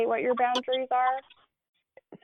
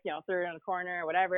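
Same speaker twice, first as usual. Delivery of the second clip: ordinary talk, nothing marked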